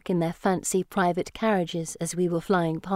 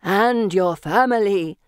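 slow, louder ghostly intoning; 4 dB louder, slower than usual. Acted. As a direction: intone it like a solemn spirit. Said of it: "Felicity intones".

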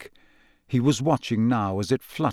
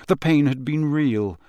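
ordinary delivery

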